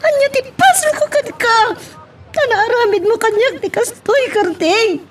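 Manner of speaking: high, crying reproach; tearful pleading. Acted. Betrayed wife crying at her husband, high and tearful. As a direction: sob out the betrayal.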